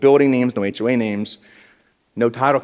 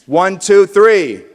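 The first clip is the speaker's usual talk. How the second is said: one, two, three pushed louder and higher; louder count; 7 semitones higher, 10 dB louder, much slower than usual. Shown as a demonstration. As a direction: really pump up the crowd. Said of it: "I don't believe you".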